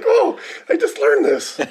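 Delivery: very high, slow, laughing squeak; mock astonished self; delighted realization. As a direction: giggle in amazed delight. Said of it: "I'm like".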